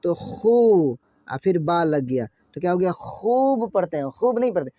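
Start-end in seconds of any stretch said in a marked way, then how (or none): 0.1-1.0 खू with a long held oo; drawn-out vowel. Shown as a demonstration. Teacher showing Urdu learners a long vowel, drawn out. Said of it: "खूब हल्का खींचना पड़ता है". 2.9-3.7 खूब with a long held oo; drawn-out vowel. Shown as a demonstration. Teacher showing learners waw maroof, drawn out. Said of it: "खूब हल्का खींचना पड़ता है".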